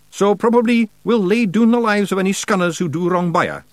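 high, loud Scots declaration; little warrior making a proud vow; proud wee Feegle. A narrator's reading. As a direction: declare your solemn duty in high Scots. Rob's character voice in dialogue.

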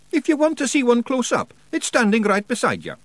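high, strong Scottish character voice; lively Scots warrior as guide; Rob Anybody, matter-of-fact Scots. Acted.

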